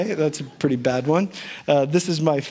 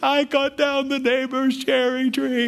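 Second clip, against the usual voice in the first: higher, tearful boy's confession, retold; imitating his own weeping as a child; 9 semitones higher than usual. Boy confessing to his parents in bed, tearful. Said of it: "just weeping".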